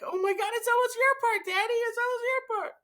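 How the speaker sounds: put-on very high, urgent kid squeal; excited little daughter. Daughter cues dad for his duet part, excited squeal. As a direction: imitate a thrilled child urging Dad on. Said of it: "and then she's like".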